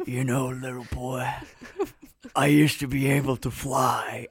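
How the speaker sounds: lightly voiced wistful horse; a hint of a sad old horse; solemn horse. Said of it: "the horse opens his mouth and says".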